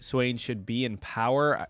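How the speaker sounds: ordinary talk, nothing marked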